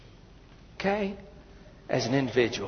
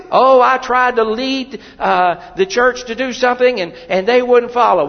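high, loud whiny excuse; mock whining; 8 semitones higher, 10 dB louder than usual. Preacher mocking a member who says nobody followed, high and whiny. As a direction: mimic a sulking churchgoer.

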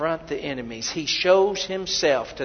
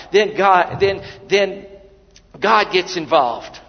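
loud, higher, driving sermon delivery; preaching at full force; 4 semitones higher, 6 dB louder than usual. Acted. Preacher telling the congregation of the battle, loud and driving. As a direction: build the fire in the pulpit.

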